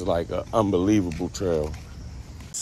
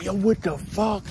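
alarmed yelp; 10 semitones higher than usual. Retold or imitated. Waking up covered in rash.

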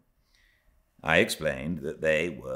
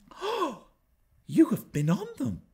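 put-on much higher, delighted child's cry; gleeful young Cathy; 4 dB louder than usual. A narrator's reading. Cathy cries gleefully to Ellen, high delighted voice. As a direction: burst out with a child's glee. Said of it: "she cried gleefully".

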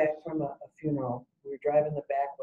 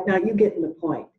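normal talking voice

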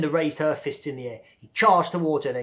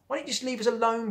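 exasperated; 10 semitones higher, faster than usual. Acted. Melinda, exasperated.

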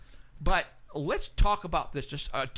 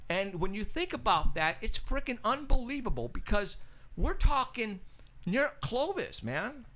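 amazed excitement; 7 semitones higher than usual. Real, not acted. Amazed how close the sightings are.

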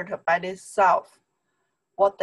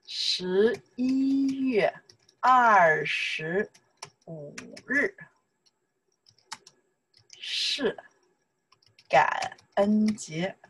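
very slow, stretched syllables in chunks; slow model sentence; much slower than usual. Shown as a demonstration. Teacher modelling the full date sentence, slow and drawn out. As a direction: draw out the date for learners.

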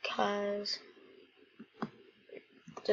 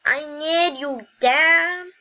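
high, loud plea; hammed-up pleading, rising louder; calling out desperately; 7 semitones higher, 7 dB louder, slower than usual. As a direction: cry out for a parent. Son calling to his dad.

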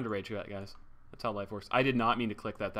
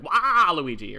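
loud, high cartoon catchphrase yell; sudden high, loud impression of a cartoon villain; Waluigi; 4 semitones higher, 10 dB louder, much slower than usual. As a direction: cry out the name like a game character. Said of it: "I'm just like".

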